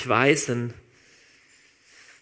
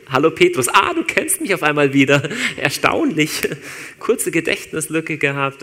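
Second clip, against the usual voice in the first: mock sarcastic Jesus; 3 semitones higher, 7 dB louder than usual. Imagined Jesus mocking Petrus.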